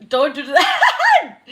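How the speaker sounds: loud, slow, high laughing protest; laughing shout. Real, not acted. Telling a teasing viewer off, loud and laughing. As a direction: yell it through laughter.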